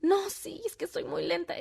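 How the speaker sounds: put-on high, soft child voice; insistent little girl. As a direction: imitate a small daughter insisting. Daughter insisting to her mother.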